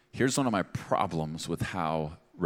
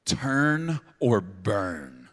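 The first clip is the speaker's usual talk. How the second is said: very slow, louder, hammed-up "Turn or burn"; mocking slogan; 5 dB louder, much slower than usual. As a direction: drag the slogan out to mock it. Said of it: "a phrase that's often associated with it".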